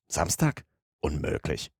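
soft questioning echo, then low dismissal; unruffled master, dropping low and soft; composed disbelief. A narrator's reading. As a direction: query it quietly, then rule it out. Calm master doubts excited servant.

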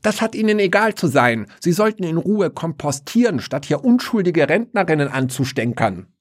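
very high, indignant granny voice; grandma scolding the voice in the grave; old woman scolding. Acted. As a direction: give him a piece of your mind. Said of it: "schimpfte die Oma zurück".

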